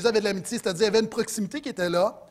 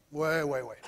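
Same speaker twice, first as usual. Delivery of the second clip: sceptical; 7 semitones lower, 7 dB quieter, slower than usual. Acted. Sceptical answer to the acted refusal.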